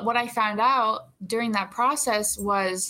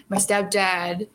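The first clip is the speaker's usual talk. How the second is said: ordinary talk, nothing marked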